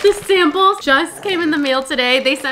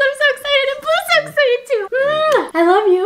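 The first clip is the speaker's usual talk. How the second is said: high cooing to the dog; cutesy pet talk; 11 semitones higher than usual. Real, not acted. High cooing to her dog Blue about the news. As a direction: coo like talking to a puppy.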